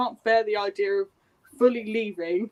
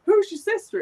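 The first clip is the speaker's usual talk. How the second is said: surprised question; 8 semitones higher than usual. Retold or imitated. Max quizzes the headmistress.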